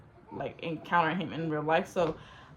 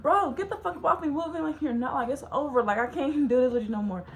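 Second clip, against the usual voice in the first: higher-pitched, fed-up telling-off; retold going off; 6 semitones higher, 4 dB louder than usual. She rejects a guy trying to kiss her, going off. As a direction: push him away with an exasperated rant. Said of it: "I'm going off".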